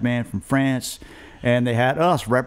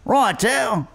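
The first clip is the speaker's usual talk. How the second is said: British driver; 10 semitones higher than usual. Retold or imitated. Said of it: "He goes".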